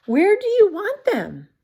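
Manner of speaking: lightly voiced bird. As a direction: ask brightly like the bird. Mother bird asks.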